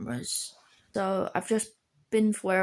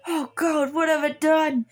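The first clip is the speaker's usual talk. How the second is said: higher-pitched theatrical dismay; mock alarm; 6 semitones higher, 3 dB louder than usual. Girl reacting to an agitated Beedrill, mock-panicked. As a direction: act out a panicked regret.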